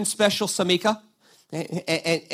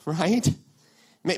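ordinary talk

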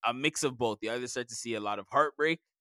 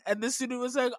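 normal talking voice

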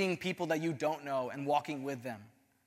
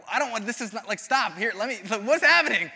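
rising flustered protest building to a loud high cry; 5 semitones higher, 6 dB louder than usual. Retold or imitated. To Rich at the shoe store, building flustered protest. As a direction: panic more with each phrase until it breaks high. Overwhelmed, retold to friend Rich.

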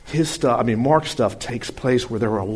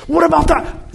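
anxious followers; 5 dB louder than usual. Acted. Followers pressing Jesus anxiously.

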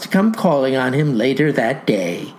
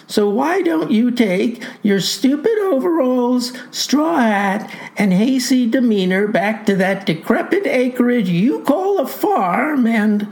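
voiced insolent youth; 7 semitones higher than usual. Boy insults the farmer.

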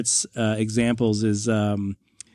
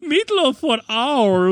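slow, high-pitched German proclamation; German accent; 10 semitones higher, slower than usual. Acted. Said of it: "that was a great German accent".

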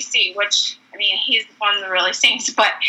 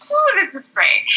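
higher gleeful coo; delighted; 9 semitones higher than usual. Retold or imitated. The girls being drenched, gleeful and high. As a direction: savor the soaking.

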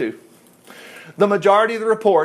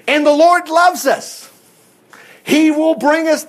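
higher, louder, slow, exultant; joyful proclamation; 8 semitones higher, 4 dB louder, slower than usual. Acted. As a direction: proclaim it like good news.

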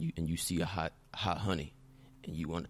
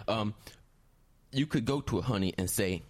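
ordinary delivery

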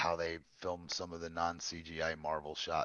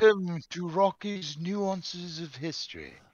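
high, slow, stately British announcer; British intro; 10 semitones higher, 5 dB louder, slower than usual. Pretend. Host announces a mock PBS history special, British intro voice. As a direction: put on a grand British show-intro voice. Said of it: "the lovely British intro".

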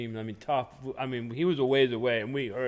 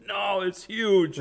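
very slow, higher, mock awe; awed whisper-slow; 7 semitones higher, much slower than usual. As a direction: stretch it out in hammed-up wonder. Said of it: "And he said".

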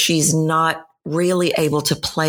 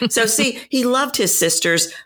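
ordinary delivery

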